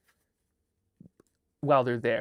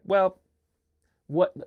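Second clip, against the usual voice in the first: mock dismissive parent; 3 semitones higher, 6 dB louder than usual. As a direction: play the parent brushing it off with fake sympathy. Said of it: "the parent is like".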